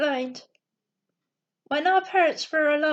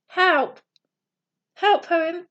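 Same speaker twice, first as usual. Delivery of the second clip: a touch louder, understated cry for help; mild narrated scream; 2 semitones higher, 4 dB louder than usual. A narrator's reading. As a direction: call for help as a restrained storybook scream. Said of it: "Milo screamed".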